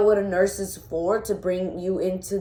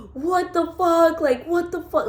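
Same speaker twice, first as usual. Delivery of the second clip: imitated scream; 9 semitones higher than usual. Retelling his reaction to seeing the injury, high imitated scream. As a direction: imitate someone screaming in shock, not at full force. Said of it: "Like, just screaming".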